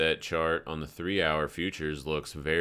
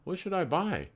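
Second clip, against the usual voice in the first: high put-on naive voice, much higher; mock naive relative; 11 semitones higher than usual. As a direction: play the clueless newbie investor. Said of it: "And they're like".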